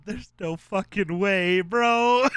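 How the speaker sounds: slow, disbelieving put-on voice; imitating Anthony. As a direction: drawl it in disbelief. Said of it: "I just love Anthony in the background".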